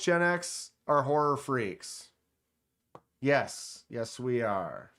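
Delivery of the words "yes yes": usual speaking voice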